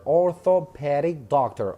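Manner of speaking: orthopedic said slowly, syllable by syllable, for students to copy; a teacher drilling the word orthopedic carefully; slow model pronunciation. Shown as a demonstration. Teacher to students: model orthopedic, slow and clear. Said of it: "teacher models the word".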